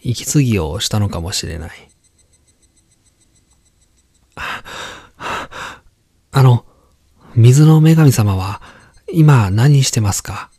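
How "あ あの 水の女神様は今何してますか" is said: put-on higher, eager child calling; young boy voice. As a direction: call out like an excited boy. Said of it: "男の子が".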